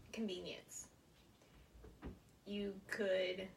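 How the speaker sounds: ordinary talk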